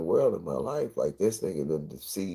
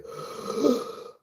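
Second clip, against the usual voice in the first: deep gasp. Shown as a demonstration. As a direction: suck in a big lungful of air. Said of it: "I fill my lungs up".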